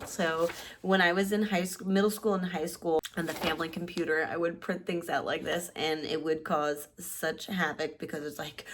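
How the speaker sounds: ordinary talk, nothing marked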